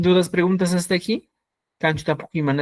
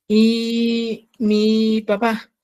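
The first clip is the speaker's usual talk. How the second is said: ¿y mi papá? drawn out very slowly with a higher rising tune; slow question; 5 semitones higher, much slower than usual. Shown as a demonstration. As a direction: stretch out every word of ¿y mi papá? as a model question. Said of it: "Mira, fíjate". (pregunta muy lenta: ¿y mi papá?)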